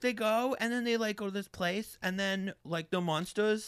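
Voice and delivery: put-on little-kid ramble; rambling kid. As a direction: imitate a child retelling a whole plot. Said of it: "you know when a kid's just".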